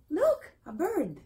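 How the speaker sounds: high, loud, excited; excited pointing out. Shown as a demonstration. Teacher shows how to point out a bird, excited call.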